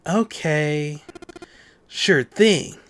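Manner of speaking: little Sonic-like douchey voice; douchey character voice. Acted. Santa answering the departing group, douchey voice. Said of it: "The little sonic douchey voice?".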